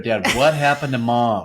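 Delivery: lightly voiced grown child, puzzled; worried kid. Kids asking dad about mom, mildly puzzled. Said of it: "they might go to".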